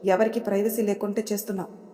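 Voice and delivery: usual speaking voice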